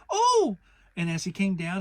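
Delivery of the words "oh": much higher, louder yelp; squeaky oh. Acted. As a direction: yelp going up. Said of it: "he said".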